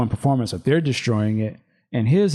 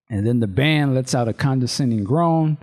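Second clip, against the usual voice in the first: ordinary delivery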